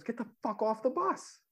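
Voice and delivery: high-pitched put-on outburst; imitating Dirty. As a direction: jump way up in pitch for a quoted order. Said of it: "He goes".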